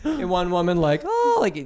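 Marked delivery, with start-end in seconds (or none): 1.0-1.4 very high mock wail; imitated crying wail. Woman caught out over the ticket, imitated crying wail. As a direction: let out a hammed-up high "oh" as if crying. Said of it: "like, crying".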